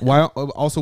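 usual speaking voice

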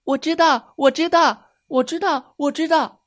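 put-on high, eager children's voices; excited children all calling out; eager kids shouting. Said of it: "小朋友们争先恐后地举手发言".

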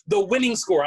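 loud, high stress on winning; emphatic clarification. Real, not acted. Repeats phrase insisting on the distinction.

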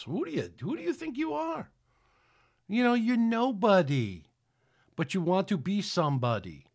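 mocking scorn. Preacher scorning a grandiose show-off, high mocking voice. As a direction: sneer down at a nobody who thinks big.

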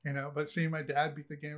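ordinary talk

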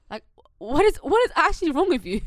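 loud, high outraged question. Real, not acted. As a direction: demand it in disbelief. Outraged rant.

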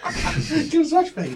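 high, slow, laughing mock bouncer; slow, high imitation of a bouncer, laughing; laughing repeat. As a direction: drag it out while cracking up. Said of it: "one of the guys was like".

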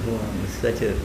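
normal talking voice

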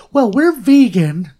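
high, loud, drawn-out mock announcement; mocking vegan contestant. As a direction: mimic a self-important contestant, stretching it out high.

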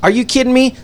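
high, loud incredulous question; retold disbelief. Retold reaction to being hit.

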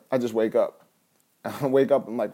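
usual speaking voice

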